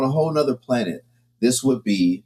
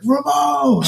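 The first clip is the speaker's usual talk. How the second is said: calling out. Retold or imitated. Kids calling out to him.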